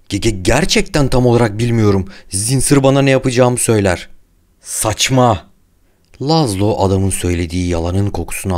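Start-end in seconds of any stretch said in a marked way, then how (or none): 0.1-4.1 high, loud, panicked stutter; frightened stammer. A narrator's reading. The doctor answering the furious Laszlo, stammering in fear. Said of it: "Dietrich tedirgin bir şekilde yutkundu". 4.6-5.5 loud, higher scornful retort; angry dismissal. A narrator's reading. Laszlo dismissing the doctor's excuse, angry.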